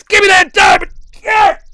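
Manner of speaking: high, loud roar of triumph; exultant shout. Real, not acted. Streamer gets his diamond, exultant shout. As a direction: scream it as the prize lands. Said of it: "hopefully something like this".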